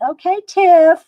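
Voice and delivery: loud, high Southern-gal imitation; Southern accent. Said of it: "She's real Southern accent".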